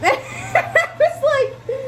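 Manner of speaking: high, slow, laughing; giggling at his request. Real, not acted. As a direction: squeak it out through laughter. Number request makes her laugh.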